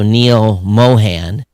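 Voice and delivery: normal talking voice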